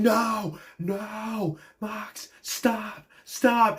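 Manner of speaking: high, strained imitation of a desperate plea; distressed pleading. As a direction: mimic begging a friend to stop. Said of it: "he's just yelling".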